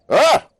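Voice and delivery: loud high yelp; a sudden sharp cry of alarm; short high startled shout. Acted. Sudden exclamation at table.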